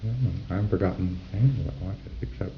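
whispered, as if thinking aloud; whispered aside. Retold or imitated. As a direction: half-whisper to himself. PC muttering to himself.

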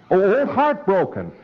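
light up when you hear Heartbroken; excited recognition. Acted. Preclear lights up at horse name.

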